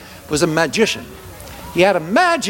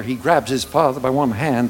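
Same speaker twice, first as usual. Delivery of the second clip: ordinary delivery, nothing marked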